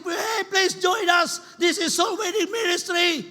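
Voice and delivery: high, stretched-out calling voice; shouting. Acted. Soul winning ministry invites people in, shouting. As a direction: call out a pleading invitation. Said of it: "soul winning ministries shouting".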